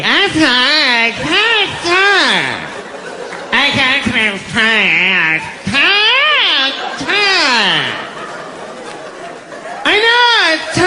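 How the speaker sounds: high, drawn-out hammed-up wail; comic high-pitched blubbering, too upset to talk; mock wailing. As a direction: bawl it out slowly, word stretched into mock sobs. Acted crying woman, laughter follows.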